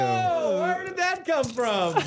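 high, slow surprised squeak; mock surprise. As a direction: play it as a hammy surprise. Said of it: "pudding that's about to fall on you".

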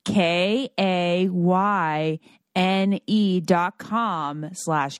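slow, letter by letter; spelling it out. Shown as a demonstration. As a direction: spell each letter out slowly. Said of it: "let me spell it out for you".

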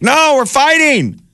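defiant general. Retold or imitated. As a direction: drag it out high and stubborn. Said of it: "general being like".

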